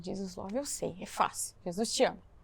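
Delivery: soft, hushed rehearsal of the phrase; practising the words softly to herself; quiet repeating. Retold or imitated. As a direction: say it under your breath to practise. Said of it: "Eu falei".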